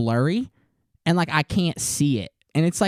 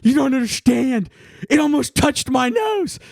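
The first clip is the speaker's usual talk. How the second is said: whiny panicked protest; 8 semitones higher, 4 dB louder than usual. Retold or imitated. Son defending his fear to his mom, high laughing whine. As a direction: protest it like a scared kid. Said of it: "And I was like".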